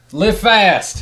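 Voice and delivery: high, loud, eager exclamation; excited. Real, not acted. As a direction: call out the title with delight. Excitedly finding the book.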